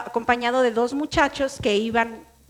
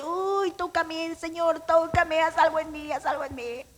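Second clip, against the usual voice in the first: pleading prayer; 3 semitones higher, 4 dB quieter than usual. Retold or imitated. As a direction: beg softly, drawing out each word. Joven rogando a Dios en oración. (súplica lenta y susurrante a Dios)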